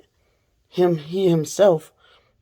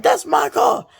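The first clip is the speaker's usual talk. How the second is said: high shouted accusation; the man shouting that the stolen car is his; crazed shout; 13 semitones higher, 3 dB louder than usual. Retold or imitated. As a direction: yell it like he's lost his mind. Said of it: "He's like".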